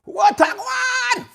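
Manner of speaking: high, loud excited shout-out; big happy hello; excited greeting. Real, not acted. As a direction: call out to a friend joining the stream. Greeting viewer on live stream.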